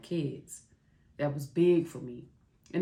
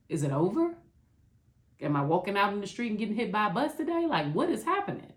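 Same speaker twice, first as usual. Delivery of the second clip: rising, frantic questions; 6 semitones higher, faster than usual. Retold or imitated. Recalling her despair, she fires frantic questions higher and faster. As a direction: pitch climbing higher and faster with each question.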